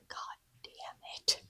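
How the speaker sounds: breathy muttered curse; whispered curse. Acted. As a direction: curse it under your breath.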